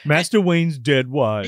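slow, dry butler line; dry British butler voice; deadpan butler. Retold or imitated. Joking Alfred voice.

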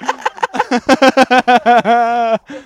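real laughing fit. Real, not acted. Podcaster cracks up at his own bit, laughing hard. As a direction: crack up helplessly.

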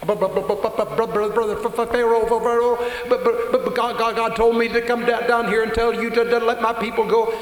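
high stuttering jabber, tripping on every word; stammering Moses. Retold or imitated. Moses stuttering before Pharaoh in high panic. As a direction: stumble over the words in a panic. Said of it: "I'll jabber".

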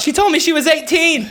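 a high, blurted guilty plea; panicked confession. Pretend.

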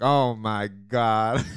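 slow, loud, fed-up groan; exasperated sigh. Retold or imitated. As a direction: drag it out, unimpressed. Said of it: "they both just walk away".

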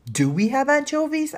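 high, loud, cheeky question; eager child. A narrator's reading. Danny pesters the housekeeper for another topping, eager. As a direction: pipe up keenly with an outrageous request.

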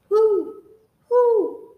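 high, loud owl hoots. Acted. Kids matching animal sounds.

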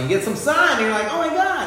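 bright high gush about the sun; excited. Retold or imitated. Friend telling of a sunny break, gushing high. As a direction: pitch up, gushing at sudden sunshine.

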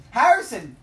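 loud high call of a name. Pretend. Calling for Harrison to come, loud yell. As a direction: yell the name across the house.

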